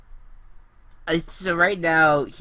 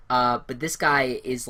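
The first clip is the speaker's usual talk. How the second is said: usual speaking voice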